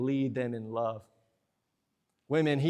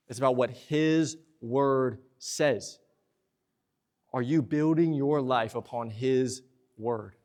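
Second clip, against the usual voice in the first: ordinary delivery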